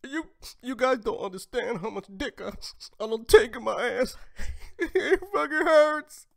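high, loud parody of crying; hammed-up wail breaking into fake sobs; mock sobbing. Host imitating woman who says it hurts, mock sobbing.

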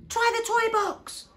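put-on high, loud urgent child's call; shout. A narrator's reading. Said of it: "shouted Pip".

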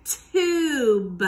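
TUBE with a long, loud oo; TUBE, drawn out and carefully sounded; model word. Shown as a demonstration. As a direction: stretch out TUBE for pupils to copy. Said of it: "Say tube".

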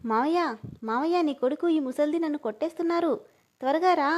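high, loud cry for uncle; girl screams to her uncle for rescue; shouting for help. A narrator's reading. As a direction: yell for uncle to come quickly. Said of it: "గట్టిగా అరుస్తూ".

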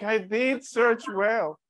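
"did" stretched out, slow and high; indignant stress on DID. Retold or imitated. Brother answering sister's doubt, indignant, stressing "did". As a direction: insist slowly, high-pitched, leaning on "did".

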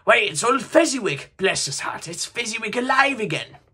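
high, loud, joyful cry; great excitement. Acted. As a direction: burst out in delighted recognition. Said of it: "Scrooge cried in great excitement".

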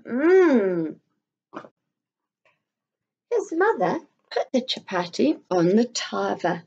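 lively storybook reading; reading to children. A narrator's reading. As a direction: read it aloud to little ones, full of wonder. Reads a picture book to children.

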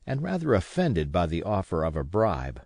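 ordinary delivery

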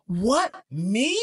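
high-pitched, drawn-out mock indignation; indignant victim. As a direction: play the wronged party, slow and incredulous. Voices an indignant victim's attitude.

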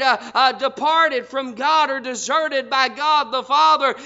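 normal talking voice

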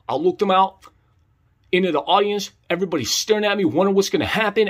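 ordinary talk, nothing marked

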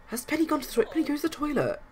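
high excited call. Acted. Urging a character to the toilet.

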